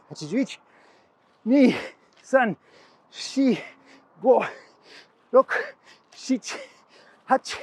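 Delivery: rhythmic count called with each strike; counting drill. Real, not acted. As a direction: call out each number in Japanese, sharp and steady. Counts strikes aloud in Japanese.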